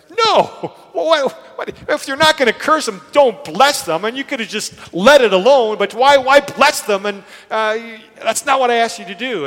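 loud, high-pitched outburst of disbelief; exasperated protest. Retold or imitated. King objecting to the prophet blessing his enemies, exasperated. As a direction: protest loudly, acting out an outraged king.

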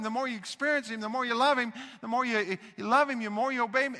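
loud, rhythmic preaching cadence; preaching at full force. Real, not acted. Preacher urges the congregation to love and obey, fervent. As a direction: drive the cycle home with fire.

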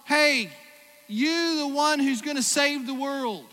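loud, high mock hail to a saviour; high loud mock cheer; mocking call. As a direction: call out to him like an eager crowd. Mocking the crowd's call to Messiah.

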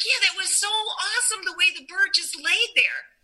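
high retold awe; excited friend. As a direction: lift the pitch in delighted wonder.

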